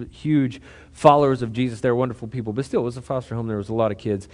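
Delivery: ordinary talk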